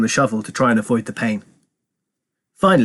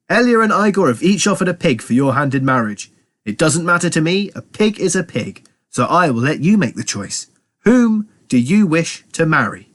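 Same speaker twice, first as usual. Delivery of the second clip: a hint of a pompous father, pitched up; lightly voiced father; 5 semitones higher than usual. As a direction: put the choice to a daughter, lightly acted, higher. Father voiced in story narration.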